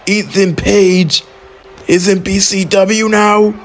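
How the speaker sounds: high, stunned play-by-play; shocked call. Real, not acted. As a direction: shout out the surprise attacker in disbelief. Commentator shocked by a surprise attack.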